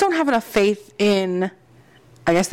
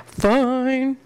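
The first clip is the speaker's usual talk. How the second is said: drawn-out, higher-pitched mock concession; FINE held long in playful protest; mock grudging; 4 semitones higher than usual. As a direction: stretch FINE into one long, higher word. Repeats it in exaggerated way, laughs.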